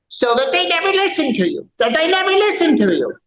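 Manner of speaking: mocking whiny voice. Mocking echo of the complaint.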